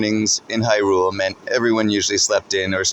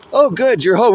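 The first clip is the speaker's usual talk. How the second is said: mom voice; 12 semitones higher, 6 dB louder than usual. A narrator's reading. Mom greets from kitchen.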